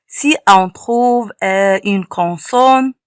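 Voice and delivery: ordinary talk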